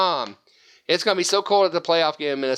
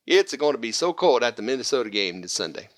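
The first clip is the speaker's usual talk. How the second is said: hammed-up Italian accent, pitched lower; lower-pitched mock Italian; 5 semitones lower than usual. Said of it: "That was my Italian accent".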